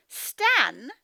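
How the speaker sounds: Stan, held with a clear stop after it; pause after Stan. Shown as a demonstration. Teacher showing children what a comma does, pause after name. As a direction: say Stan, then pause before going on. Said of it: "I pause a little bit after I said the word Stan".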